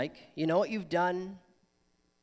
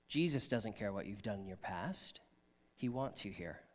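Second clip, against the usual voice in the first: softer, tender pastor's promise; reassuring; 7 semitones lower, 7 dB quieter than usual. Real, not acted. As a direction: gently tell them they belong. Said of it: "reassures the congregation".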